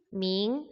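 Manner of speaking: 밍 drawn out with a rising second tone; second-tone Mandarin. Shown as a demonstration. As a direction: lift 밍 upward like a question, as a tone demo. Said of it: "이성".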